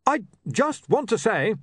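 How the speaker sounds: slow and high, backing down; slow, sagging protest. A narrator's reading. Barbarian climbing down before Rocky, speaking slowly. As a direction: pick the words out carefully. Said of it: "said Breezok slowly".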